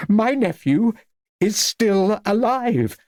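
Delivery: high-pitched, drawn-out declaration; emphatic proclamation. A narrator's reading. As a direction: proclaim it high, stretching the end. Old lady proclaims nephew lives.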